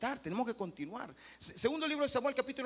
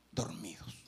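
soft unvoiced whisper, much lower; whispered verdict; 14 semitones lower, 4 dB quieter than usual. Real, not acted. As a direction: breathe the word out. Judging the sleeping church.

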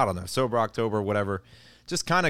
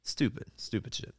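softly muttered under breath; 9 dB quieter than usual. Retold or imitated. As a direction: mutter it to yourself. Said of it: "So I'm like".

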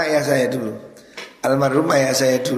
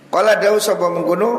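raised, chanting kitab read-out; kitab recitation; 6 semitones higher, 4 dB louder than usual. Acted. Preacher reading the kitab to the congregation, chanting. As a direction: intone the text like a pesantren reading.

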